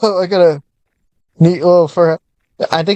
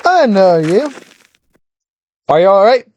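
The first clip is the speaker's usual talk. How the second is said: put-on old-time radio announcer voice; lightly voiced vintage newsreel announcer; 1930s newscaster voice; 5 semitones higher than usual. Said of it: "Why am I talking like a nineteen thirties newscaster".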